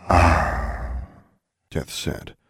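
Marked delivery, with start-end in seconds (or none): 0.1-1.0 a long, drawn-out "Ah". A narrator's reading. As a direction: stretch the Ah out long. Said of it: "in a long, drawn-out way".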